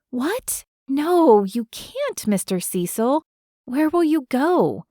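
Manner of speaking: high-pitched dismayed disbelief; the news hits her out of nowhere; shocked. A narrator's reading. Peyton shocked by Cecil leaving.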